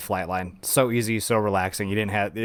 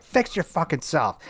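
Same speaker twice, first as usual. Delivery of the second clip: retold telling-off; 6 semitones higher than usual. Repeats telling-off before driving away.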